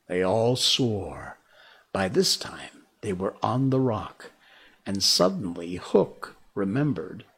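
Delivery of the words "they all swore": usual speaking voice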